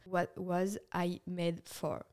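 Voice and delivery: slow, careful English title, trying hard; concentrating to get the English right, slowly. Shown as a demonstration. Vlogger retrying the [name] title, slow careful English. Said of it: "je me concentre".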